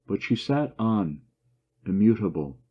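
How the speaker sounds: usual speaking voice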